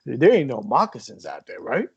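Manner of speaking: imitating dad's thick North Jersey accent; mock Jersey dad, playing it up; hammed-up Jersey dad voice. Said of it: "I love the fake accent that you gave him".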